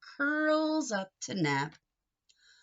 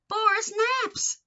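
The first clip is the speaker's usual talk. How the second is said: high mock exasperation; 12 semitones higher, 4 dB louder than usual. Sleepy cat ignores everything.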